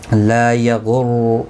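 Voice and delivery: slowly drawn لا یغر; tajweed demo. Shown as a demonstration. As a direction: draw out the لا for one alif length. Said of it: "لا الف مدہ ہے".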